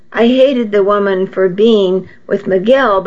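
ordinary delivery

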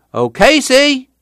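high hailing holler; calling out. A narrator's reading. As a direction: holler the name across a yard. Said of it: "he called".

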